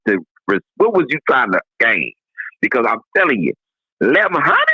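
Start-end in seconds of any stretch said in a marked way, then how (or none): 4.0-4.7 much higher, rising incredulous question; can't believe the number; shocked disbelief. Real, not acted. Disbelief at the price.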